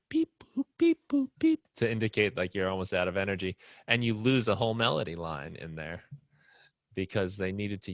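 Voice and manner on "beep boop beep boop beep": high chiptune beeps; beeping game sound. Retold or imitated. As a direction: squeak out the beeps like an 8-bit chime. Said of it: "It's like".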